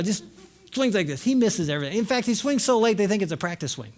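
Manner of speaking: ordinary delivery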